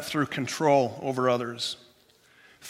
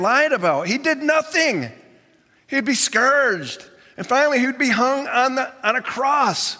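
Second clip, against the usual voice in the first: raised, rising sermon voice full of dismay; impassioned preaching; 7 semitones higher, 3 dB louder than usual. Acted. Preacher to congregation, voicing disciples' dismay, impassioned and higher. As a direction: preach it as the disciples' bitter letdown.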